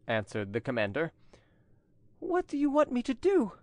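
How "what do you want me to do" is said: high, distraught female voice; desperate pleading. A narrator's reading. Angelique begs the commander for terms, desperate. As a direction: ask helplessly, pitched very high.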